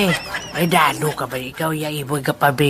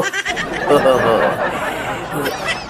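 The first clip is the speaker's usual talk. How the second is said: ghostly laugh. Acted. Ghostly laugh after death talk.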